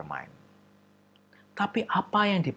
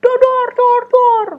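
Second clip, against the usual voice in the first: gunshot sounds; 15 dB louder, slower than usual. Acted. Child playing gunfire sounds.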